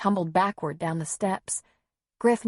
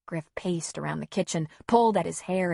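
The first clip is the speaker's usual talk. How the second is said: usual speaking voice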